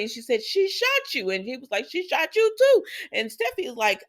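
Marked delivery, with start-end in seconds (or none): 0.4-1.3 high-pitched panicky retelling; mock alarm. Steffi freaking out at Finn, mock alarm. 1.9-2.8 very high, imitated outcry; hammed-up panic, pitch way up; mock alarm. Finn and Steffi on Sheila's shooting, mock alarm.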